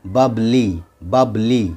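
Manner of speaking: बबली ending in a long ee; final y as ee. Shown as a demonstration. Teacher gives another example, बबली ending in ee. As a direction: say बबली with y sounded as ee. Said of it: "उसी तरह से एक और example है".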